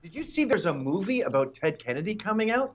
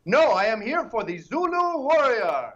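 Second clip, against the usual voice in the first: higher and louder hammed-up proud voice; mock congressman voice; 5 semitones higher, 5 dB louder than usual. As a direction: puff up a silly proud declaration. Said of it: "he just said".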